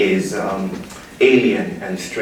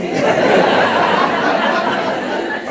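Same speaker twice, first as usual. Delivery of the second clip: big laugh. Real, not acted. Laughing at audience reaction.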